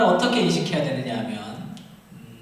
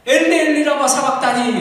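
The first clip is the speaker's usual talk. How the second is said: anguished cry; 7 semitones higher, 9 dB louder than usual. Acted. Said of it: "예수님도 하나님에게 버림을 받았죠".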